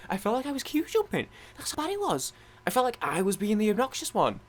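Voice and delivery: very high, squeaky protest; aggrieved embarrassment. Real, not acted. Gamer telling how pushy they felt in the queue, aggrieved. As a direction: push the voice way up in exasperation. Embarrassed about pushing past crowd.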